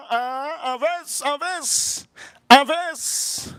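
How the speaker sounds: high-pitched imitation of a father; put-on high dad voice; friend's father. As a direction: chant it high, like an odd dad. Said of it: "And then the father goes".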